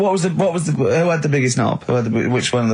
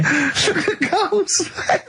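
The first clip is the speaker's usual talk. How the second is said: high, slow words squeezed out through laughter; can barely speak for laughing; high-pitched giggling at Carl's face; much slower than usual. Real, not acted. Cracking up at Carl.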